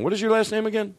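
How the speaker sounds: ordinary talk, nothing marked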